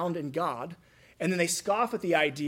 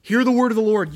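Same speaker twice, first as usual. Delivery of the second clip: loud, raised shout, "Hear" driven hard; commanding shout; 5 semitones higher, 10 dB louder than usual. Acted. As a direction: demand their attention. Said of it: "He's commanding their attention".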